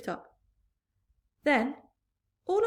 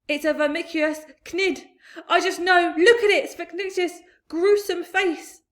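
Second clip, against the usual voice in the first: high, loud frightened rant; terrified; 9 semitones higher, 11 dB louder than usual. A narrator's reading. Police chief terrified of the creature. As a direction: spit it out in horror.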